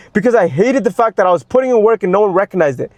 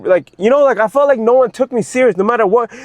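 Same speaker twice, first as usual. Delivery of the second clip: normal talking voice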